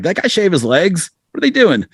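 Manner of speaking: mock baffled at the young band; baffled disbelief. Old bandmate retelling his confusion, mock baffled and high.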